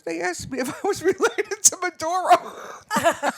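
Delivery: high, loud, laughing through the words; helpless laughter while telling it; cracking up. Real, not acted. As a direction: break into real laughter on the punchline. Cracks up telling husband's story.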